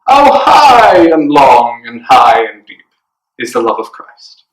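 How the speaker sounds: hammed-up English accent, slow and drawn out; exaggerated English accent, loud start falling away. Shown as a demonstration. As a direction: put on a posh-play English accent, swooping down. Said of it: "in your best English accent".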